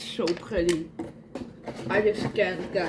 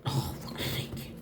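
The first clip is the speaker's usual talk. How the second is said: whispered curse; 7 dB quieter than usual. Real, not acted. Struggling to open box.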